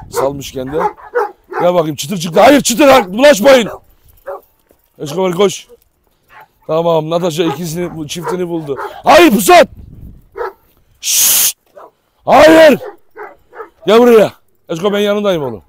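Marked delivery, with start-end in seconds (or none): 2.4-3.7 warning shout. Real, not acted. Warning dogs off. 9.0-14.3 high, loud no at the dog; stern warning shout. Real, not acted. Stopping a dog from attacking.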